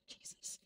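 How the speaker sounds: breathy sighed Jesus; a tired sigh before Jesus; weary sigh. Real, not acted. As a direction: exhale it out, spent. Sighs, overwhelmed.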